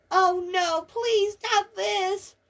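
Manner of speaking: high, whining protest; dreading plea. Acted. Dreading Red Madness, high pleading whine. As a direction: plead to be spared.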